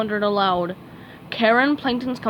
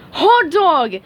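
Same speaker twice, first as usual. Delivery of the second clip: higher, loud gleeful whoop; yelled; 7 semitones higher, 8 dB louder than usual. A narrator's reading. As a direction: cheer at the customers. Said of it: "he yelled".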